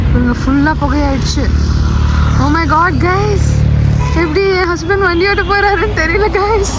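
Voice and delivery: high excited squeals to the viewers; rising giddy disbelief in the rain. Real, not acted. Excited about mist, rain. (உற்சாகமான உயர்ந்த குரல்)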